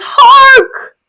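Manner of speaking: high loud startled call; sharp alarm. Acted. Lodovico startled by a cry in the night. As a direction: snap to attention at a cry.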